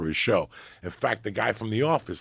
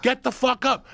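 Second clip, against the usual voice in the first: high, loud exasperated outburst; worked-up shouting; 7 dB louder, slower than usual. Real, not acted. Ranting to his co-host about plane passengers, shouted. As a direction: rant at full voice, pitch way up.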